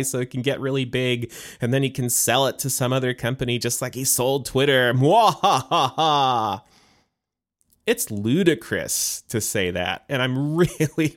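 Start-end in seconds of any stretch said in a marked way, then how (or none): 4.9-6.6 slow, louder evil villain cackle; sneering panto villain. Pretend. Mocking the conspiracy theory.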